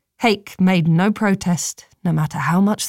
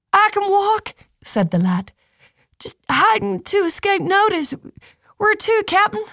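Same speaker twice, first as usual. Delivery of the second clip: put-on young lad voice; 13 semitones higher than usual. Lad's voice, much higher.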